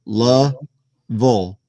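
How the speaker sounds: "Louisville" drawn out in two parts, LUH-vul; slow local pronunciation. Shown as a demonstration. Breaking it down for visitors, local pronunciation. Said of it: "you say Louisville".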